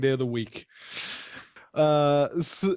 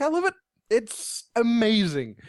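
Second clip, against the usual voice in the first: much higher, gushing delight; excited gushing; 8 semitones higher than usual. Real, not acted. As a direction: rave about it, thrilled. Gushing over the game music.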